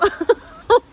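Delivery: high loud laugh; burst of laughter. Real, not acted. Nurse admits she eats too, loud laugh. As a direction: crack up laughing.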